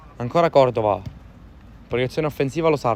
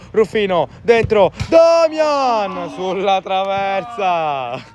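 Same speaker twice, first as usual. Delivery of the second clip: rising, louder play-by-play build; breathless italian goal commentary; 3 semitones higher, 4 dB louder than usual. Real, not acted. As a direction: build to a slow cry at the bar. Attack ends on crossbar. (telecronaca affannata in crescendo)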